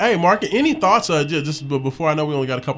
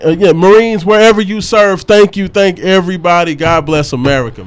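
booming military hype shout-out; loud gung-ho soldier; 3 semitones higher, 10 dB louder than usual. Real, not acted. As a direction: roar it like a proud Marine.

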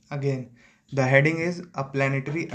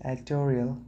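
British-style "Editorial", middle syllable squeezed; British accent; 4 dB quieter than usual. Shown as a demonstration. Reader testing how British people pronounce editorial, British accent. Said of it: "editorial in British".

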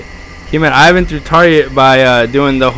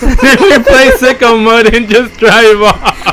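laughing through the words, slow; 9 semitones higher, slower than usual. Real, not acted. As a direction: giggle while telling it. Laughing while retelling prank.

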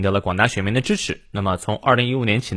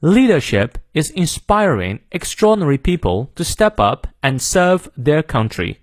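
British accent; 4 semitones higher than usual. Shown as a demonstration. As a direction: read it in British English, dropping R's after vowels. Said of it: "好，英式发音". (英式发音示范朗读)

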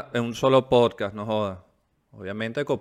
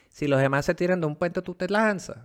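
high-pitched scolding mom; mother's warning voice; 7 semitones higher than usual. Retold or imitated. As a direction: voice a nagging mother.

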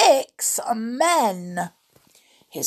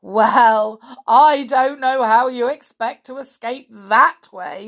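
loud, raised, gloating voice; a touch of gloating mirth; roaring, amused giant; 4 semitones higher, 9 dB louder than usual. A narrator's reading. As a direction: boom it out mockingly. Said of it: "roared with laughter".

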